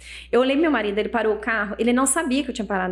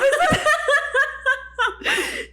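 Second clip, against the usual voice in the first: long, high-pitched imitated crying; mock wail. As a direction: wail in exaggerated sobs, very high. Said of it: "você chorava?". (choro agudo imitado)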